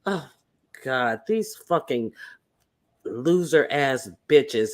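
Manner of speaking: ordinary talk, nothing marked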